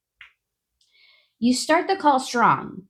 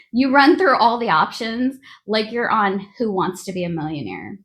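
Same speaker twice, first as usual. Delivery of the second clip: usual speaking voice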